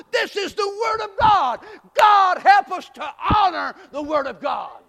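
loud, high, urgent preaching; prédication enflammée. Real, not acted. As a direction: build to a shouted plea to God. Preaching at full force.

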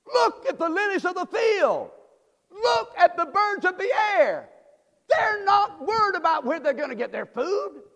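high, loud shouted scripture; Jesus voiced, shouting. Pastor voicing Jesus to the church, high shout. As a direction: cry it out like a prophet. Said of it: "I see Jesus saying".

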